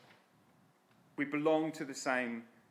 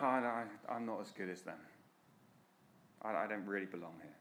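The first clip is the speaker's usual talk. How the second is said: much softer, halting self-doubt; insecure mumble; 6 semitones lower, 8 dB quieter, faster than usual. Retold or imitated. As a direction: mumble it like you feel you don't belong. Voicing an insecure believer.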